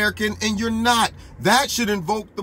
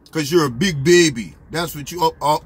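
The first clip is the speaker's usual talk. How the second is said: ordinary talk, nothing marked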